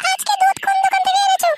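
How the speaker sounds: extremely high, squeaky wife voice; interrogating wife. Acted. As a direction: demand an answer in a tiny piercing voice. Another wife questions husband. (বউয়ের ভীষণ সরু, চড়া গলায় জেরা)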